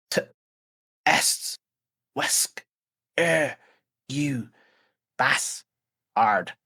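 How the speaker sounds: halting, breathless syllables; wounded Vin cursing Wesker between gasps; gasping broken words. Acted. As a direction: force each piece out through pain.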